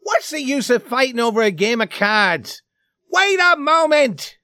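high-pitched urgent plea; begging. A narrator's reading. Easterner begging the brawlers to wait, high urgent pleading. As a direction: plead to be heard over the uproar. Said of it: "he was begging in a voice".

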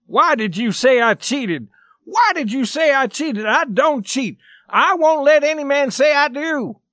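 high, heated, insistent demand; indignant challenge. A narrator's reading. Accused player pushes forward at accuser, indignant challenge.

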